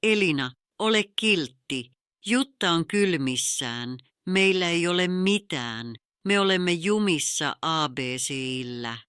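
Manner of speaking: ordinary delivery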